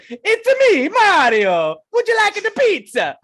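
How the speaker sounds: very high, loud fake Italian; Mario accent. Gamer oblivious to the class, screaming Mario accent. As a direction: shout it as a cartoon Italian plumber. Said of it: "the bullshit Italian accent".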